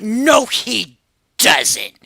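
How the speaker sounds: slow, stretched-out no way; drawn-out disbelief. Retold or imitated. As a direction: drag it out like you can't believe it. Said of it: "You probably heard that in my voice".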